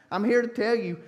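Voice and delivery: normal talking voice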